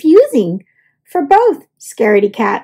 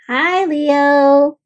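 ordinary delivery, nothing marked

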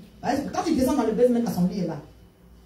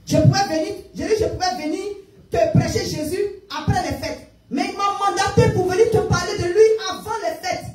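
high-pitched, emphatic preaching plea; urgent insistence; 6 semitones higher than usual. Retold or imitated. Evangelist refusing to wait until after the holidays, urgent and insistent. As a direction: drive it home, no time to wait.

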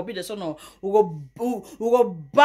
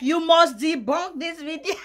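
laughing; 7 semitones higher, 4 dB louder than usual. Real, not acted. Laughing at her own joke.

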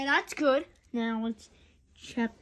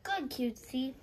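normal talking voice

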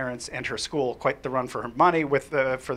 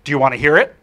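louder, direct question; eager offer; 10 dB louder, faster than usual. Retold or imitated. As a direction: offer it boldly. Girl offers her song.

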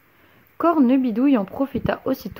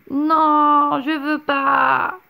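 higher, slow whine through a yawn; sleepy child's no; whiny yawning child; 4 semitones higher, 4 dB louder, much slower than usual. A narrator's reading. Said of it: "rétorqua Pierre en bâillant".

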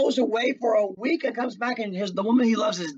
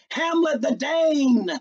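proud Hamlet; 8 semitones higher, 5 dB louder, slower than usual. Acted. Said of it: "Hamlet comes from where he's hiding and says".

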